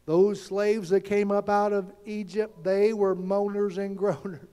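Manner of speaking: usual speaking voice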